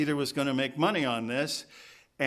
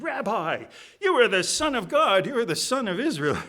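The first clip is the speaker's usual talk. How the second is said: high, loud acclamation; awed exclamation; 9 semitones higher, 6 dB louder than usual. Acted. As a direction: proclaim it in astonished excitement. Acting Nathanael's amazed confession.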